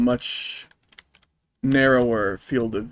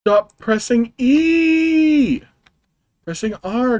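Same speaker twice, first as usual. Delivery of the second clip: high, loud and very slow enraged scream; howling at the game for pressing the wrong key; drawn-out furious yell; 11 semitones higher, 5 dB louder, slower than usual. Real, not acted. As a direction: stretch the command out in a howl of rage. Yelling at the game controls.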